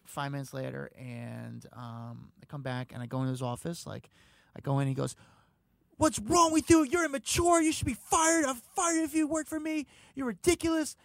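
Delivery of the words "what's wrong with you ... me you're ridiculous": much higher, loud furious berating; angry scolding. Retold or imitated. Trump berating the guy who botched the mic, in a furious high yell. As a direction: tear into him like a raging boss.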